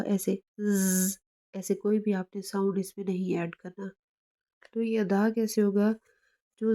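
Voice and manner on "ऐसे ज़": ज़ said slowly with a buzzing z; ज़ with a buzzing sound, the wrong way. Shown as a demonstration. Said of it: "कोई भी buzzing sound नहीं होगा".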